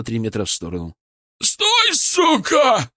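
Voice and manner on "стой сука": high, loud bellowed order; furious yell. A narrator's reading. Crawling Бычок screams at the retreating narrator, furious yell. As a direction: yell after someone getting away. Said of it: "орал Бычок".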